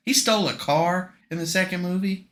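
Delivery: ordinary delivery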